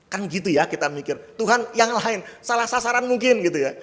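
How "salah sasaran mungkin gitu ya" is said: usual speaking voice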